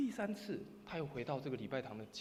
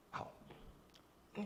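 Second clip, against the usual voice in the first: ordinary talk, nothing marked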